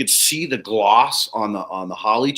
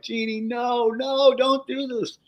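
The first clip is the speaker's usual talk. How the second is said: high, slow mock plea; mock pleading; 12 semitones higher, slower than usual. Pleading with Jeannie not to show the neighbor, high and slow. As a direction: beg her to stop, pitched up. Begging client to stop.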